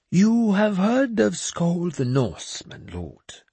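put-on high, slow, grandly pious old madman; a cracked holy man delivering God's message; mad bishop voice. Jeremias asks lord.

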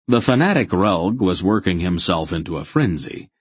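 ordinary delivery

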